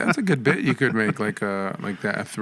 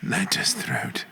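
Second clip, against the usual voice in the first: whispered echo; 7 semitones higher, 5 dB quieter, much slower than usual. Pretend. Echoing tavern toast.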